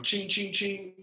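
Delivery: high mock ching ching as bottles clink; clinking sound effect. Mimicking bottles clinking while grabbing them.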